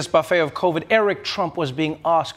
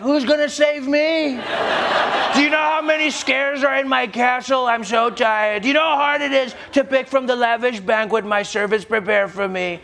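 whiny prince; 5 semitones higher than usual. Pretend. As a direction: whine like a spoiled prince. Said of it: "And he's like".

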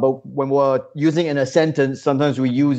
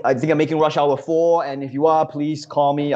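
ordinary talk, nothing marked

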